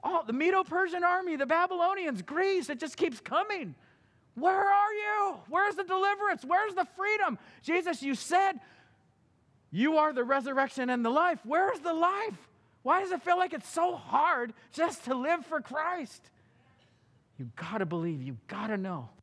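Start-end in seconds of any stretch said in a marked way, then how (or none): 0.0-3.8 high, loud fervent preaching; preaching at full force. Acted. Preacher roaring to the congregation, full fervor. 4.3-16.3 anguished complaint. Acted. Daniel crying out to God, loud despairing wail. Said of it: "moaned and groaned and complained".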